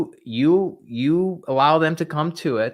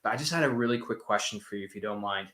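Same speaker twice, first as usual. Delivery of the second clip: soft polite ask; 4 semitones lower, 10 dB quieter than usual. Shown as a demonstration. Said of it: "You say".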